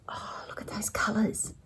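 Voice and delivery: breathless, unvoiced whisper of awe; admiring whisper. Real, not acted. Stitcher admiring purple silks, in a rapt whisper. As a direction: hush it as if the colours might vanish. Admiring silk colours close up.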